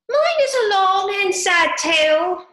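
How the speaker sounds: high, loud squeaky mouse voice; a squeaky little mouse lamenting his tale; sighing mouse. A narrator's reading. Mouse sighing to Alice, high squeaky lament. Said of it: "turning to Alice and sighing".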